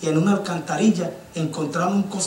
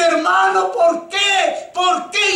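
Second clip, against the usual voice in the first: high desperate shouting; anguished why; 11 semitones higher, much slower than usual. Retold or imitated. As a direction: scream the question to heaven. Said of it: "gritaba y gritaba y gritaba".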